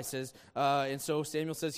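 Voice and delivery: ordinary delivery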